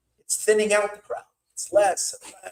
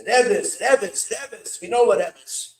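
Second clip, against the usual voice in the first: scoffing; 4 semitones higher, 7 dB louder, much slower than usual. Retold or imitated. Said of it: "He says".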